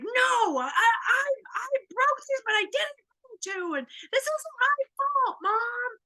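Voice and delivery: high, loud tearful protest; high crying angry child yelling at mom. Retold or imitated. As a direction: shout it out like a crying, defensive kid. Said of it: "but she's angry now".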